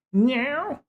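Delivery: high mock meow at the cat. Real, not acted. Imitating the cat.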